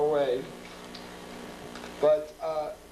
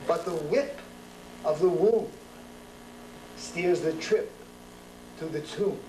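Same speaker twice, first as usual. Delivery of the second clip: measured, rhythmic poem recital with pauses; poetry reading; 3 semitones higher than usual. Acted. Poet reciting Saint Mark's Place poem to a live audience.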